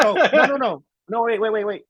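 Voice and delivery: high, flustered backtracking; acted-out flustered protest; mock panic. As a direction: blurt it out like you've messed up. Retold panicked backtracking over a mistake.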